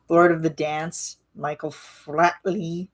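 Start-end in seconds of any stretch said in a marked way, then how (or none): none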